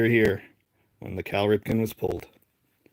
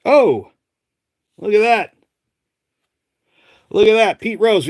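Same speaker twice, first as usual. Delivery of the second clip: loud, much higher thrill at the pull; excited; 9 semitones higher, 7 dB louder than usual. Real, not acted. Collector thrilled at pulling a Pete Rose, loud and much higher. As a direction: exclaim it like you just hit the jackpot.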